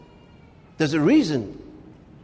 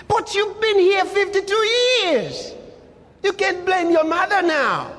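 high exhorting rebuke; 9 semitones higher than usual. Real, not acted. Preacher confronting the crying woman.

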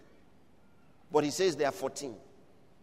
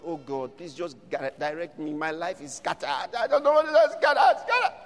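a half-hearted whine of a lost soul; mock lament; 5 dB louder than usual. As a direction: play the lost soul lightly pleading. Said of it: "Don't cry".

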